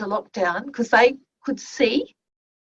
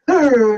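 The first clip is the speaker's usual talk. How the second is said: loud imitated growl; foul-mood growl; 8 dB louder than usual. Boy slumps into class in a foul mood, growling. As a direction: growl like a grumpy student. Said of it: "he was in a foul mood".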